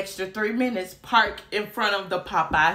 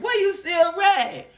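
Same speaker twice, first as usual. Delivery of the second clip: shouting disbelief; 8 semitones higher than usual. Retold or imitated. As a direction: yell it like you can't spot it. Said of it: "my binocular eyes was looking like".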